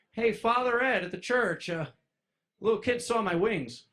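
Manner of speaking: high, loud urgent report; hammed-up urgent plea to the priest; frantic call to the priest. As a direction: blurt it out like a panicked phone call. Phoning the priest in panic.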